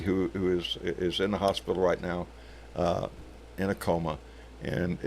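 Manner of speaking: real grief, voice sinking low and soft; heavy-hearted, quiet; sad. Real, not acted. Friend in a coma, breaks heart.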